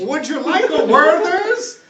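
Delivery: very high, loud, drawn-out granny voice; shrieky-high old lady, slow and loud; grizzled old lady. Acted. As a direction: offer it slowly like a doting old woman. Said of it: "a grizzled old lady and she's like".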